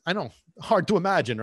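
normal talking voice

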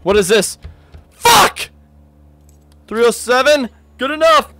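very high, loud frantic yells; panicked shouting. Real, not acted. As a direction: yell in a panic. Panicked during a chase.

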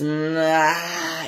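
long, drawn-out "ah"; sustained, relaxed vocal release; released open-throat "ah". Shown as a demonstration. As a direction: let the sound spill out from the belly. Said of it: "it comes out like that".

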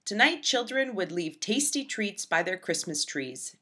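ordinary delivery, nothing marked

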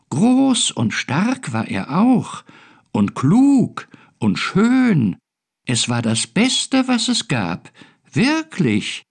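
high, swooping, drawn-out storytelling; exaggerated high creature voices; boasting little creatures. A narrator's reading. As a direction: brag in a sing-song tiny-creature voice. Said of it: "Findus hatte ihre Angeberei bald satt".

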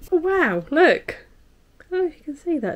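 high, delighted gasp of wow; thrilled at the sparkle, voice jumping up; excited. Real, not acted. Sees sparkly lip balm.

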